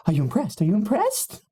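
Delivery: fishing for admiration; high eager character. Acted. Character asks, voiced high.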